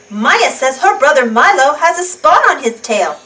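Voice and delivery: usual speaking voice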